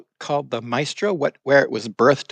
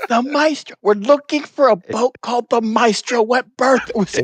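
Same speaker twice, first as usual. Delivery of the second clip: whisper-shouting; 11 semitones higher than usual. Acted. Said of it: "he whispers shouting across the room".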